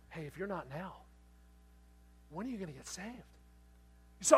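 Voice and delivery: normal talking voice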